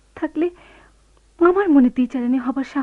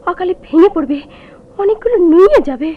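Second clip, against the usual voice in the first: much louder, strained cry; anguished outburst; 5 semitones higher, 10 dB louder than usual. Acted. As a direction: let the grief burst out. Wife's anguished storm prophecy. (যন্ত্রণায় জোরে ফেটে পড়া)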